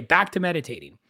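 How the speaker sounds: ordinary talk, nothing marked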